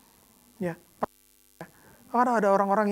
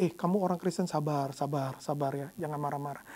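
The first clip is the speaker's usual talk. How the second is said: lower, softer "sabar, sabar" like a calming friend; mock soothing advice; 8 semitones lower, 8 dB quieter than usual. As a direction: hush him down patiently. People urging patience, mocked.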